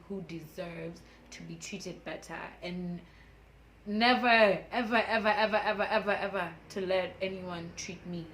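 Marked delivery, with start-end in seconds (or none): 3.9-8.3 louder, laughing string of ever; emphatic never ever. Real, not acted. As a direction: hammer each ever with a laugh. Vowing never again, laughing.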